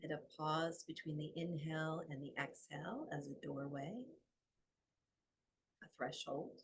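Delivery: soft, unhurried meditation voice with long pauses; guided meditation. Acted. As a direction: guide them gently into the breath.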